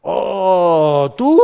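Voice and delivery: loud, scoffing "oh, you"; mock outcry. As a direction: jeer at the new believer. Friends react with mocking shout.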